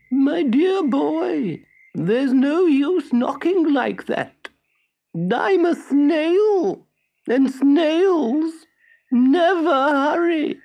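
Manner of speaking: high, very slow creature voice; slow snail. A narrator's reading. The snail answers Pinocchio from upstairs, very slow. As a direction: stretch every word lazily.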